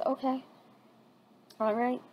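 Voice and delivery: soft, calm okay; calm gentle voice. Acted. Tazuna hears the truth, calm and gentle. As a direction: settle him down gently, no rush. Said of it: "very calm, gentle voice".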